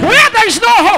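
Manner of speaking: high, forceful preacher's invocation; fervent preaching. Acted. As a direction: call out a blessing over the congregation. Call and response preaching.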